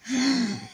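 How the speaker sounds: sharp high intake of breath; deep gasp. A narrator's reading. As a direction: gasp before ranting on. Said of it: "to draw a deep breath".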